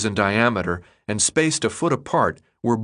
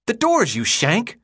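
higher, exasperated snap at a newcomer; exasperated boy; 12 semitones higher, slower than usual. Acted. Chuck scolding Thomas about the doors, exasperated. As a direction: tell him off, impatient.

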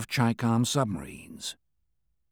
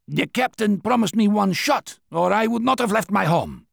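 lightly voiced stubborn old soldier; a hint of an insistent old veteran; soviet soldier; 9 semitones higher, 4 dB louder than usual. A narrator's reading. Old veteran insists on his shot.

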